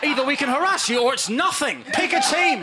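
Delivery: high, insistent put-on outrage; mock indignant ultimatum. Men give women their only options, mock indignant.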